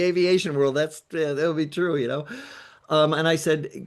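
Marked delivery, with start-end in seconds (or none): none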